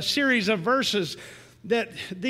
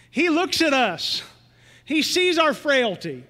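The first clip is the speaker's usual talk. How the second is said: slow, rising preached cadence; sermon climax, drawn out; building preaching; 4 semitones higher, 4 dB louder, slower than usual. Acted. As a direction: preach it home, slow and lifted.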